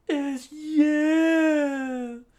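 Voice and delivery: sharp high loud sneer; high taunting villain. Acted. Unrepaired taunting his enemy, high loud sneer. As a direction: spit the last word out high. Villain line in manga reading.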